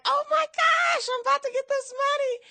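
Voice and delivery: very high-pitched excited squeal, re-enacted; all excited. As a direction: squeal it like you're about to win big. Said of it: "all excited".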